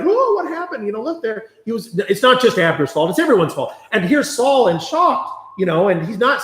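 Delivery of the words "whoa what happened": high-pitched mock surprise; startled reaction. Everybody waking up to the news, mock startled. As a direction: act out a sudden waking startle. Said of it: "and everybody's like".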